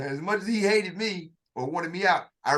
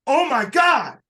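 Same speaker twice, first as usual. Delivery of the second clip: high, loud burst of amazement; stunned disbelief; 8 semitones higher, 6 dB louder, slower than usual. Retold or imitated. Shooter reliving his first hit, amazed. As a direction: gasp it out like you can't believe the hit. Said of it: "Now, I was like".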